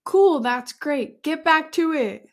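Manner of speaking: ordinary talk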